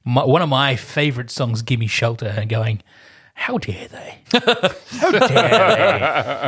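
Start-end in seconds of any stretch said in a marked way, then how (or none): 3.3-6.5 high, hammed-up indignation breaking into laughter; comically affronted, pitched right up; mock outrage. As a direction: play offended, then laugh at it. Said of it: "I remember being really angry".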